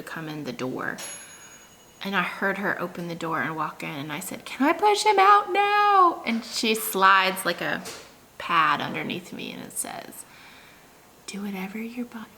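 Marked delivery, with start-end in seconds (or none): 4.4-6.2 high, slow, strained asking; high strained plea. Retold or imitated. Retelling her plea during labor. 11.3-12.3 whispered. Retold or imitated. Retelling midwife's hushed words.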